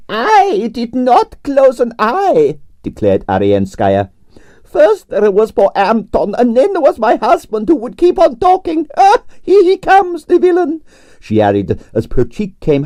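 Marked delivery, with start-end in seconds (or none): 0.1-10.8 grand Russian ballerina complaining about her night; Russian prima ballerina. Acted. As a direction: play the dramatic Russian diva. Arienskaya held voice.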